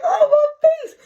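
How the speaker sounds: very high, loud wail of pain; a kid howling after getting hit; crying out in pain. Retold or imitated. Mark hit by mama.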